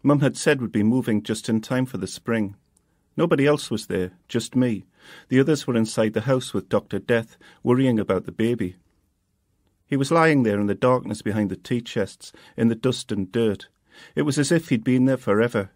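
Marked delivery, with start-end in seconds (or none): none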